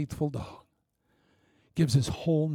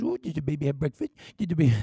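put-on teasing baby talk; 2 semitones higher, much faster than usual. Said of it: "She goes".

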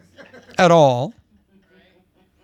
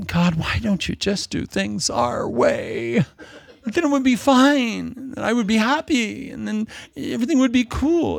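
whiny believer; 6 semitones higher than usual. Pretend. Mocking a complaining believer.

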